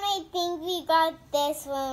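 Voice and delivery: normal talking voice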